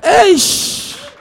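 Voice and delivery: loud, high startled "Eish"; a sudden gasp of disbelief; shocked exclamation. Retold or imitated. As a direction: blurt it out in shock. Pastor's shocked reaction.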